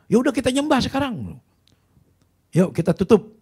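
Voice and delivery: high, loud mock call to wrap up; mocking rival pastor. Rival pastor mocking the sermon, shrill high call.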